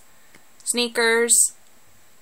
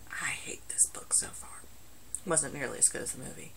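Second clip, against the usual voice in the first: whispered aside; 6 semitones lower, 16 dB quieter than usual. Real, not acted. Aside about the book.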